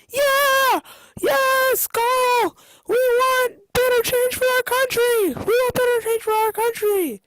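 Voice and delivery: high, loud crowd yells; cheering crowd. Acted. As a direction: shout along like an eager rally crowd.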